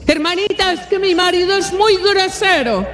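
a lightly voiced complaining woman; mock whiny complaint. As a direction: play a put-upon sister moaning about her mother-in-law. Church sister complaining about husband.

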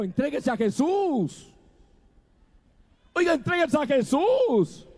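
pleading sermon climax; fervent exhortation. Acted. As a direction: press the listeners to give themselves to Jesus. Preacher urges surrender to Jesus.

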